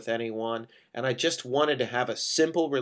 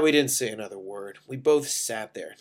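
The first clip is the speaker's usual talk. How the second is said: usual speaking voice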